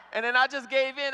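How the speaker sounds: ordinary delivery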